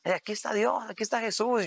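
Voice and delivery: high, excited cries of praise; retold joyful shouting. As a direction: shout it out like a crowd praising God. (gritos de alabanza, agudos y emocionados)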